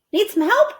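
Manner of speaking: high, loud friendly offer; boy voiced. A boy offering Amelia Bedelia help, high. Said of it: "said someone".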